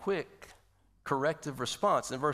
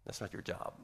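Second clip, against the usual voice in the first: whisper; 5 semitones lower, 10 dB quieter than usual. Shown as a demonstration. Same line repeated, hushed.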